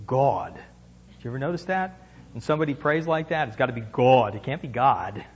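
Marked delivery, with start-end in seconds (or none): 0.1-0.5 lower, drawn out mock-solemn God. Shown as a demonstration. Preacher mocking the pastoral prayer pronunciation of God. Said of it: "And you must say". 3.5-5.2 deep solemn voice. Shown as a demonstration. Preacher mocking pastoral prayer voice. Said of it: "in that deep voice".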